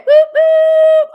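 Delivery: high, loud whoop whoop; squealing celebration whoop; excited cheer. Real, not acted. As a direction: cheer it out, thrilled. Celebrating an appointment booking.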